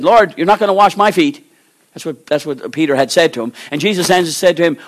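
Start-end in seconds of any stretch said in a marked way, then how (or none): 0.0-1.5 Peter's loud protest. Acted. Peter refuses Jesus washing his feet, loud protest. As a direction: raise the voice in alarmed refusal.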